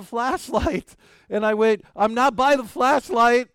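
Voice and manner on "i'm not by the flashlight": put-on higher and louder child's call. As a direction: shout to the searchers from down the ridge. Child calling out in the dark.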